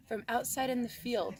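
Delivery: plain reading voice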